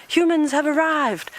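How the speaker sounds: high, drawn-out mock alarm at no real volume; mock alarm shout. Guide voicing Egyptian geese alarming at the visitors, mock alarm shout. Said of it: "They're shouting, saying".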